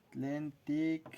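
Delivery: ordinary talk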